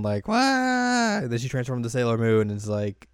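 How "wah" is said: high, loud cartoon "waah"; hammed-up panicked wail; mock wail. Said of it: "Usagi's just, like, running around".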